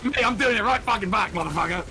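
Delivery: mock threat.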